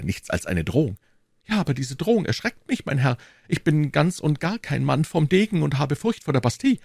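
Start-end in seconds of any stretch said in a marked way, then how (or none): 1.5-6.8 lightly voiced higher-pitched townsman; a hint of a nervous informant; anxious bourgeois. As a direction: voice a worried citizen, lightly raised in pitch. Bourgeois admits his fear.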